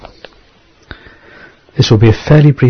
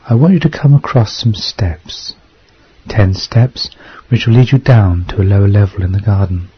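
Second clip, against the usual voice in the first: guided meditation. Acted. Leads a hypnosis meditation.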